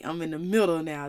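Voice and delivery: ordinary talk, nothing marked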